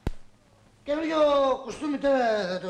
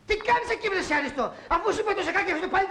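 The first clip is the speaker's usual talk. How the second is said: high-pitched, exasperated protest; fussing indignantly over a jacket; indignant scolding; 7 semitones higher, much faster than usual. Acted. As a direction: scold in a high, agitated rush. Scolding over the mistreated new jacket.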